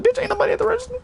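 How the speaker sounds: very high, squeaked mock protest; indignant retort. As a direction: shoot back in a high put-on voice. Retold retort to fussing coworker.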